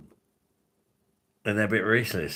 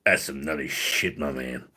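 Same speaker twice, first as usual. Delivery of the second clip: low-pitched put-on voice; Randy; 6 semitones lower than usual. Trying out Randy's voice with nutty lines, low impression. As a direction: drop the pitch right down for the catchphrase. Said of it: "Randy's voice".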